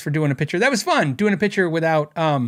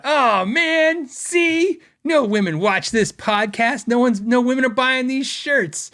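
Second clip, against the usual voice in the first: mock dismay; 8 semitones higher, slower than usual. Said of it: "And I thought".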